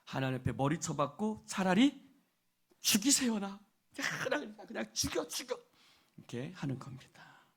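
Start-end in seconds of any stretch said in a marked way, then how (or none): 1.5-5.6 mock tantrum prayer, pitched higher; 떼쓰기. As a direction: whine it like someone demanding to be finished off. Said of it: "하나님 앞에 떼 쓸 때가". (떼쓰는 흉내, 높은 목소리)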